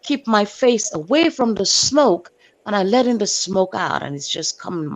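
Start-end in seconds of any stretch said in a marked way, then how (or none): none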